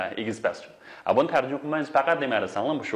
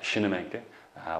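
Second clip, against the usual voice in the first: ordinary talk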